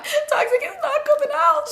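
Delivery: very high, laughing wail; laughing whine. Retold or imitated. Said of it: "and I'm like".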